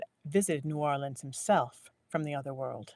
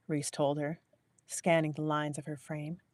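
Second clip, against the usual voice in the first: usual speaking voice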